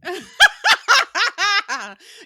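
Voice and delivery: long, high, loud peals of laughter; big cackling laugh. Real, not acted. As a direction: crack up completely. Cracking up over clown David.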